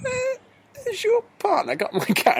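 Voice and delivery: high, startled woman's voice; woman calls out about her cat; imitating woman. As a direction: squeak it out like a surprised neighbour. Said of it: "the woman went".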